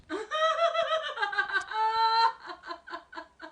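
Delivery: high-pitched giggling fit; long high laugh. Real, not acted. Laughs after joking about a release.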